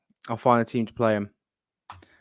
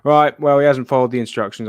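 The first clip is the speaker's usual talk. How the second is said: firm; 8 dB louder than usual. Real, not acted. Disqualifying the winner.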